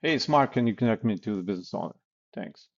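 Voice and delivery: brisk caller expecting to be connected; confident, fast, no pause for a reply; relaxed and confident. Shown as a demonstration. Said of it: "you're relaxed and confident".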